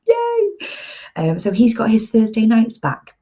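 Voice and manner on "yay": cheer. Real, not acted. Mock cheer for partner.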